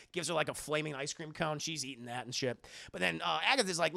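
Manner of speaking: usual speaking voice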